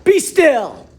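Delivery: high, loud order; commanding shout. Acted. Max commands the wild things, loud and high. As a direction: stop the monsters with one command. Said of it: "Until Max said".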